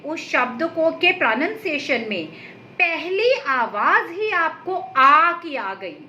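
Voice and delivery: ordinary delivery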